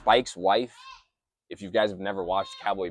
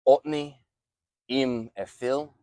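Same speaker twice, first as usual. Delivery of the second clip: slow, drawn-out backwards phrase; backwards speech; 4 semitones higher, 4 dB louder, slower than usual. Shown as a demonstration. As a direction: say the reversed words slowly and carefully. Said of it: "language is a little hard to say backwards".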